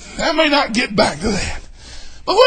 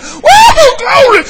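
high, loud, slow whooped praise; mock church whoop; war whoop; 10 dB louder, much slower than usual. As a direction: let it rip like a war whoop. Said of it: "cut loose with one of them war whoops".

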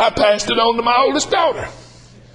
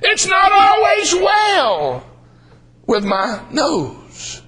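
preaching refrain; 5 semitones higher, much slower than usual. Acted. Preacher to congregation, shout then hush.

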